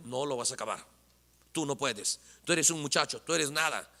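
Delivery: low, soft, belittling voice; quietly write off the youngster, low; dismissive put-down. Acted. Leader answering young David, quietly dismissive.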